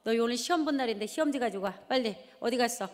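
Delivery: fast, impatient demand; hurrying them. Retold or imitated. Mother rushing kids to bring their test papers, fast. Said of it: "재촉을 합니다".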